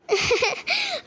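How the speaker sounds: short, high giggle of disbelief; amused laugh. Acted. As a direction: giggle at being called the wrong name. Girl laughs at being misnamed. (ضحكة استغراب)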